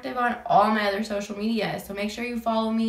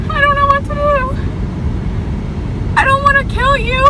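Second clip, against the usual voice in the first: high, loud, fast fearful cry; frightened wail; 8 dB louder, faster than usual. Real, not acted. Driver afraid of killing the bird, high wail. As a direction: plead in real fear. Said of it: "I'm scared".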